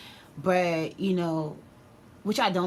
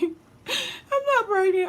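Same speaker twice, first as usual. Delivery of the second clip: very high, drawn-out comic wail; exaggerated mock crying; 4 dB louder, much slower than usual. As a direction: bawl it slowly in a squeal, hamming up the tears. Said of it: "I'm just crying".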